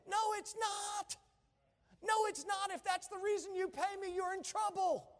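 worked-up preacher, high-pitched rebuttal. Real, not acted. Pastor rebutting church members who say it's his job, worked up. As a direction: push back hard, pitched well up. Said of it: "I'm getting a little worked up".

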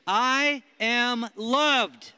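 slow, loud and high affirmation; loud declaration. Shown as a demonstration. Preacher leads the room in declaring, slow and loud. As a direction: shout it like you believe it. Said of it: "say 'em like you believe them".

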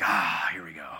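low, weary mock groan; exasperated. Husband groaning at his crying wife, exasperated. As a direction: sigh it out like a fed-up husband. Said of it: "I'm like".

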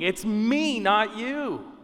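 emphatic retold cry; mild mock scream. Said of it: "God is screaming".

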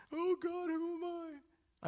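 high, drawn-out mock wail; hammed-up crying to God; mock weeping. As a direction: fake a tearful, repentant plea. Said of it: "I started to weep and I cried".

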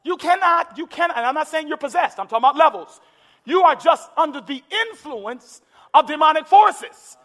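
ordinary talk, nothing marked

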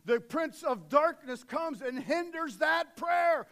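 loud and slow, hammering each word; drawn-out pulpit shout; slow preaching shout. Acted. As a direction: bellow the warning slowly. Dramatic warning in sermon.

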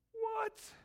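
very high-pitched, squeaky "what"; shocked disbelief. Acted. Mock shock at demon's outburst.

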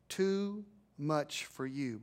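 slow, each word set apart; slow unison phrase. Shown as a demonstration. Pastor leading congregation in a slow repeat. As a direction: draw it out for them to repeat. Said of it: "phrase I want you to say with me".

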